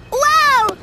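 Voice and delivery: high, loud delighted wow; amazed wow. Acted. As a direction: burst out in amazement. Découvre ses pouvoirs. (waouh émerveillé et fort)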